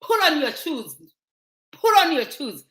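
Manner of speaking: "Pull on" for put on, no hard t; American-style slurring. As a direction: imitate Americans saying "Pull on". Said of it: "they say "pull on".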